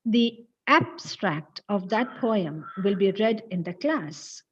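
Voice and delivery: "abstract" with AB set off and punched; stress on first syllable. Shown as a demonstration. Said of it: "the first syllable should be stressed".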